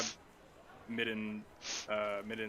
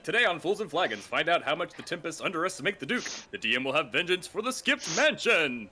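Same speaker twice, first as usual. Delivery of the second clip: louder, higher trailer voice; dramatic announcer read; 7 semitones higher, 9 dB louder than usual. Shown as a demonstration. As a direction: read it like a show intro. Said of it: "I just noticed Martyr's comment".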